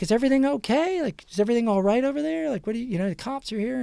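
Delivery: worried neighbor lady. Retold or imitated. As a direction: pitch it up like a nervous mom at her mailbox. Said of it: "she's like".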